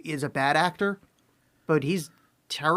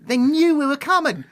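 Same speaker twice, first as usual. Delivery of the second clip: Gordon's line redone in a mock English accent, higher and louder; imitating English accent; 10 semitones higher, 7 dB louder than usual. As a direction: parrot the clip in a hammed-up English accent. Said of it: "he just says that in an English accent".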